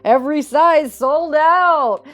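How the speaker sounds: loud, theatrical villain monologue; gloating villain. Acted. Pheasant gloats over heartbroken hens, loud and theatrical. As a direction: savour the despair of others grandly.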